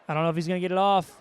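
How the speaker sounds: fast tense play-by-play; rushed. Acted. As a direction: rattle it off against the clock. Clock running down, rushed call.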